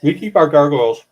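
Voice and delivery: half-choked on food; forcing words out past a cough; choking while eating. Real, not acted. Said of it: "and choking".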